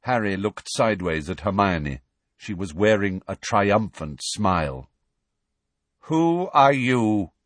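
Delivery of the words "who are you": slow, loud, stern challenge; stern centaur. Acted. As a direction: challenge them slowly.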